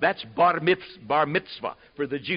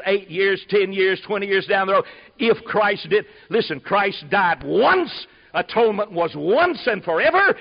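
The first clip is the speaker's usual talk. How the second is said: fiery preaching; 3 semitones higher, 5 dB louder than usual. Acted. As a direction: preach it to the back row, hammering every all. Preaching at full force.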